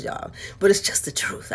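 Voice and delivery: confiding whisper; whispered aside. Real, not acted. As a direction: whisper it to the viewers.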